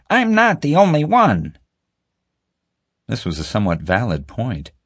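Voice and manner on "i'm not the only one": high, pleading female character voice; pleading woman. A narrator's reading. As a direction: plead your case, voice pitched up.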